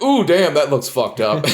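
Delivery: shocked reaction. Retold or imitated. Friends react to gruesome burns.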